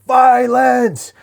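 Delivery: very high, loud, gleeful war cry; screaming. A narrator's reading. Shield beating up everyone, screaming. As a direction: scream the word with wild relish. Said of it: "as the shield screaming".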